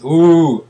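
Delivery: loud, high, drawn-out ooh; mock alarmed "ooh". Mimicking the news reacting to a government, mock alarm. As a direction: hoot it like exaggerated alarm.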